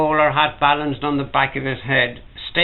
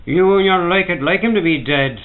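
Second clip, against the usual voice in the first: furious, higher and louder; hot with fury, pitched up and loud; 4 semitones higher, 6 dB louder than usual. A narrator's reading. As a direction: spit the accusation at him. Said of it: "said a hurler furiously".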